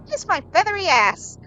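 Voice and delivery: high, loud parrot voice; foul-mouthed bird talking back; rude parrot. Acted. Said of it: "And the parrot says".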